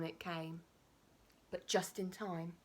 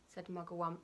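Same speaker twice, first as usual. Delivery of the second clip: ordinary delivery, nothing marked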